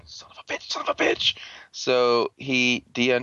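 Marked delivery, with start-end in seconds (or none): 0.0-0.7 very soft, voiceless swearing; hushed curse. Retold or imitated. Ian caught out by his teammates, hushed cursing. 0.7-1.4 high-pitched, strained cursing; mock outburst. Ian caught out by his teammates, squeaky cursing. Same curse repeated, blown up.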